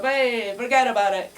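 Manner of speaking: put-on a loud, punchy Brooklyn Italian accent; imitating a Brooklyn Italian accent, shouted out. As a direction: bark it like a Brooklyn Italian cop. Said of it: "kind of accent".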